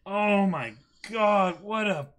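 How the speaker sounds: high, loud, drawn-out amazement; excited. Real, not acted. Reacting to the volume's ending with co-host, loud amazed outburst. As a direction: blown away, let it burst out.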